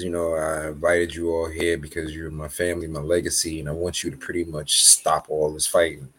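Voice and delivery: a hint of a weary old king pleading; mild, tired royal plea. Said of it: "The king says".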